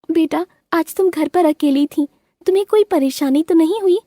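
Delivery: high gentle mother bird instructing her daughter; high-voiced mother bird explaining to her daughter. A narrator's reading. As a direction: speak as a caring mother bird. Mother asks.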